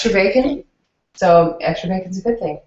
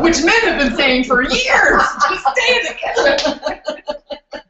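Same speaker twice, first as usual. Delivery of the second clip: slow, higher, laughing jab; laughing tease; 7 semitones higher, 3 dB louder, much slower than usual. Real, not acted.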